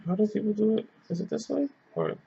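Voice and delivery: muttering to herself. Real, not acted. Wondering aloud how shotgun works.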